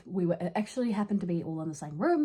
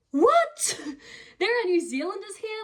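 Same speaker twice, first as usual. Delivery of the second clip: a high, loud, squeaky "what?" of amazement; imitating herself gasping in surprise; shocked disbelief; 11 semitones higher, 4 dB louder than usual. Retelling her shock at meeting Kiwis abroad, high surprised squeal.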